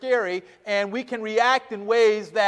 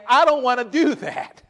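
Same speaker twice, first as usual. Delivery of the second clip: Paul's line said through a laugh, higher and louder; amused, raised quote; 7 semitones higher, 5 dB louder than usual. Retold or imitated. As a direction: chuckle while voicing Paul. Voices Paul, laughing.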